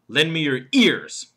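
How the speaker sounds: "ears" punched loud at the end; slow line landing hard on "ears"; stress on ears. Shown as a demonstration. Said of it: "then there's the fourth word".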